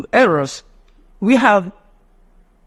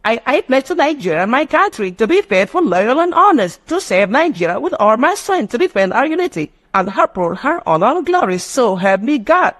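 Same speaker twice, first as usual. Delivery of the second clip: put-on sing-song pledge in a high childish voice, each phrase rising and falling; wrong way recited; 6 semitones higher than usual. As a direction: chant it like a class of kids. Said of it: "is it national pledge".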